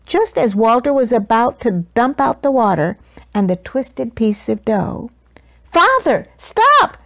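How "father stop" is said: put-on very high, alarmed child's cry; urgent shout. A narrator's reading. Son stopping his father at the bakery, high urgent shout. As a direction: call out sharply to stop him. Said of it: "shouted Walter Junior".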